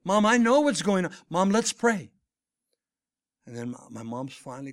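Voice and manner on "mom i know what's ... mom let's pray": louder, higher, exasperated retold appeal; insistent interruption. Son cutting off his mother's account to pray, loud and insistent. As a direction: cut in firmly over her talking.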